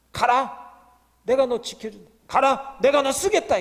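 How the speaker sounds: loud, high commanding shout; God's command. Acted. God shouting orders at a reluctant Moses.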